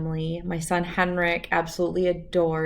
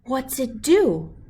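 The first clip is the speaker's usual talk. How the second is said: put-on slow, higher small-child voice; curious little boy; 7 semitones higher, 5 dB louder, slower than usual. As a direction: ask wide-eyed about the compass. Little sick Albert asks father.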